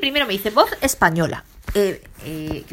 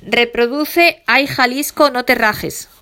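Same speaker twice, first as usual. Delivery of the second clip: voice command to Siri; 3 semitones higher, 5 dB louder, much slower than usual. Shown as a demonstration. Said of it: "si yo le digo a Siri".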